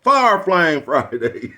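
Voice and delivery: hype catchphrase. Real, not acted. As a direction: belt "Fire Flame Friday" like a stage drop. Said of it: "hyping catchphrase".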